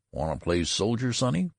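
put-on casual question to a boy, rising at the end. A narrator's reading. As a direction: ask offhandedly, lifting the last word. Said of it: "demanded the clerk casually".

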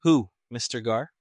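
crisply voiced question, lifting at the end; crisp officer's query. A narrator's reading. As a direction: ask it briskly, a little emphatically. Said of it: "He spoke crisply".

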